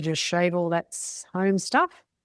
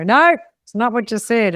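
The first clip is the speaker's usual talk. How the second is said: mock correction; 5 semitones higher, 10 dB louder than usual. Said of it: "And they go".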